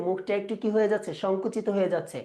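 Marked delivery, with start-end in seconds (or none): none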